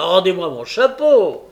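story character's loud angry order; angry demand. A narrator's reading. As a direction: demand it back forcefully. Sikes demands his hat back.

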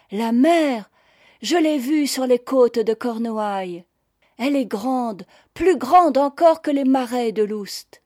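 enthusiastic. A narrator's reading. Noël describes the sea he saw, enthusiastic. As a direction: marvel aloud at the sea. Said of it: "rayonna d'enthousiasme".

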